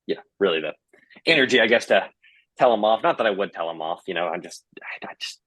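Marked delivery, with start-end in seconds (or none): 4.1-5.4 trailing whisper. Real, not acted. Trailing self-deprecating aside.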